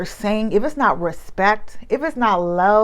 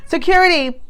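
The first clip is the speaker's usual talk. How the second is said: higher-pitched mock call for security; 8 semitones higher, 4 dB louder than usual. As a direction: call out for a guard, acted. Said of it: "call security and be like".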